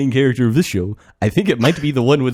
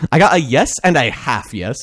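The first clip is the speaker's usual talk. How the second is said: usual speaking voice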